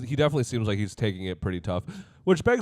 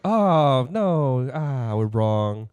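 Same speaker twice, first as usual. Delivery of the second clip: fake sadness; 2 semitones higher, much slower than usual. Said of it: "They're like".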